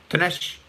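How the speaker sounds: "Tanesh" with a stressed, emphatic T; "Tanesh", practising a firm initial T. Shown as a demonstration. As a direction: lean on the T of "Tanesh". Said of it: "I put the emphasis on the T".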